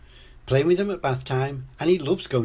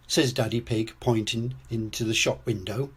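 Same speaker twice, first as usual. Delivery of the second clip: ordinary delivery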